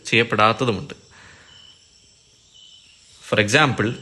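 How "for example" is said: ordinary delivery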